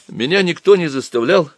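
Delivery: put-on high-pitched boyish protest; acted flustered, childish excuse; timid babbling. A narrator's reading. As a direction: stammer it out like a guilty boy. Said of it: "пролепетал Боря".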